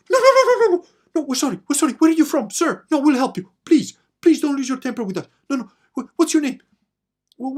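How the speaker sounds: high, loud, laughing mock begging; panicked pleading. Big guy pleading with Tony, panicked and high. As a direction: beg for your life like a scared giant. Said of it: "he's like".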